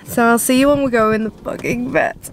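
ordinary delivery

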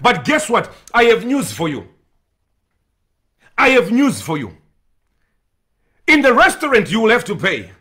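ordinary talk

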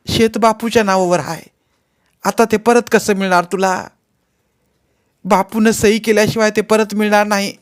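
put-on high-pitched, pleading old woman's voice; an aggrieved old widow begging for help, voice pitched high; old village woman. Old woman continues complaint. (उंच आवाजातली तक्रार करणारी म्हातारी)

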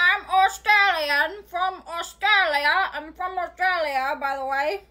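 put-on slow, drawn-out Australian accent. Girl claims to be from Australia, in a put-on Australian accent. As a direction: lay on a fake Australian accent, stretching the words. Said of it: "Where'd your accent go?".

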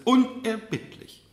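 loud, high, drawn-out refusal; firm interruption. Acted. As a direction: cut her off with one slow, hard word. Mother cuts daughter off.

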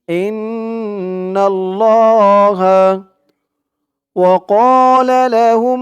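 slow, melodic recitation of the verse; Quran recitation. Shown as a demonstration. Teacher reciting the verse for students to repeat. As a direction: chant it slowly and clearly. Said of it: "আমার সাথে সাথে ঠোঁট নাড়িয়ে বলবেন".

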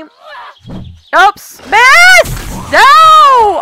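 loud high cry of dismay; frustrated cry. Real, not acted. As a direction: yell out at the miss. Missing the swing at zombie.

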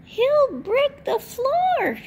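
squawky hen voice, alarmed and slow; clucking smaller chicken. A narrator's reading. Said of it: "clucked the smaller chicken".

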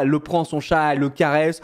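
ordinary delivery

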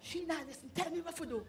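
soft, secretive urging; hushed. Acted. Friend begs for secret advice, hushed. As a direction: ask it quietly, wary of eavesdroppers. Hope your mama not listen.

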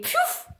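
breathy "piouf" vanishing noise; whooshing poof. A narrator's reading. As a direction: make a magic whoosh of it. Vanishing sound effect in the story.

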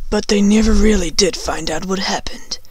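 soft, half-whispered narration; hushed mystery. A narrator's reading. Narrator on the unsolved cause of the fire, hushed. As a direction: drop to a secretive hush.

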